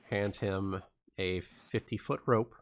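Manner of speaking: normal talking voice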